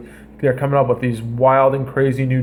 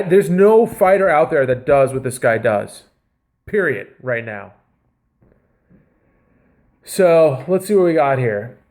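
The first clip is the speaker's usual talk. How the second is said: ordinary talk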